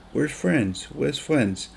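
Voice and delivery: imitating his little son. Son asking for his friends.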